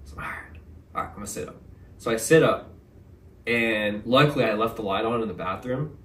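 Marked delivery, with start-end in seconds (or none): 0.1-1.6 hushed self-talk; whispering. Retold or imitated. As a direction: breathe it out under your breath.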